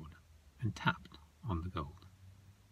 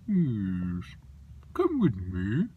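Snoswatch creature voice; 6 dB louder, much slower than usual. Acted. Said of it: "And he talked like this".

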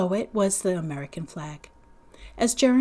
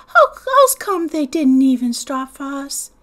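put-on upset little boy; 5 semitones higher, 8 dB louder than usual. Small boy asking upset.

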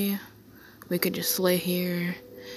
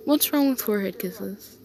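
ordinary talk

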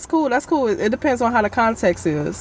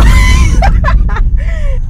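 loud laugh; 9 dB louder than usual. Real, not acted. Laughs at gold digger jab.

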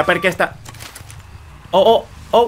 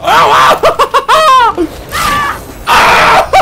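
very high, loud shrieks breaking into laughter; startled screaming laugh; 5 dB louder, much slower than usual. Real, not acted. Streamer shocked as the game floor drops, screaming with laughter.

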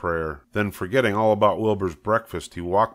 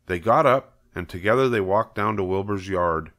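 ordinary delivery, nothing marked

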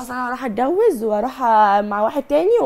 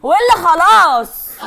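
slow, much louder furious yell; furious shout; 5 semitones higher, 8 dB louder, much slower than usual. Real, not acted. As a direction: drag out each word in rage. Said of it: "اهدى على نفسك شوية". (صراخ غاضب وبطيء)

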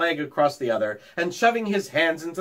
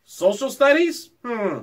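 puzzled; 7 semitones higher, 5 dB louder than usual. A narrator's reading. Stuart puzzled at unknown subject.

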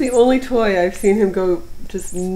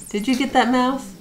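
pet talk. Real, not acted. Cooing at the cat.

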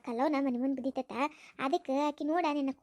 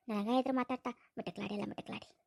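muttered insult; 6 semitones lower, 6 dB quieter than usual. Acted. Scheming insult under her breath.

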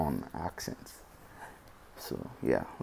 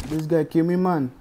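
put-on Dominican accent; 9 semitones higher, 8 dB louder than usual. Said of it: "we using the Dominican accent today".

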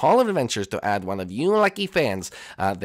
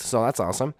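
usual speaking voice

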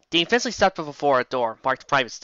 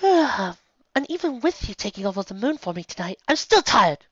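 put-on high young voice; 8 semitones higher than usual. Luna tired.

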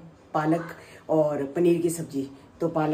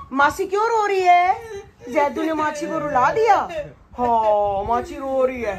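mock crying; 9 semitones higher, 5 dB louder than usual. Aunt fakes crying for baby.

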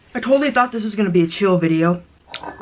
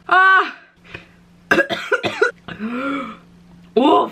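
ordinary talk

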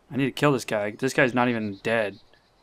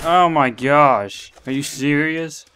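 loud, slow, drawn-out amazement; amazed; 3 semitones higher, 6 dB louder, slower than usual. Real, not acted. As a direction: stretch it out in disbelief. Amazed at own kill.

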